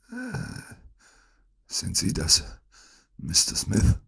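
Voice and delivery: soft, breath-heavy sickbed murmur; feeble whisper. A narrator's reading. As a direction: whisper like a very sick man. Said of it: "flüsterte Holmes". (schwach geflüstert)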